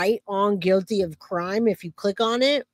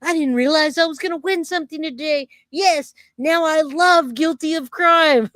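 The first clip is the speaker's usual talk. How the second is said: excited viewer voice; 7 semitones higher than usual. Retold or imitated. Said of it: "V says".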